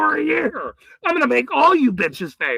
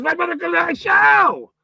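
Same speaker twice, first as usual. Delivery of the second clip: normal talking voice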